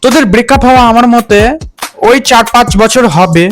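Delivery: usual speaking voice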